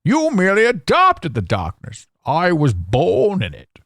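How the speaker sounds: a light Bane voice, high and slow at the end; lightly voiced masked villain, menacing; Bane impression. Podcaster quoting the movie villain, hint of Bane. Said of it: "Kinda sound like Bane there".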